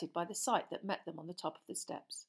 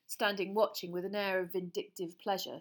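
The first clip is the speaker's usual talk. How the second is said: ordinary delivery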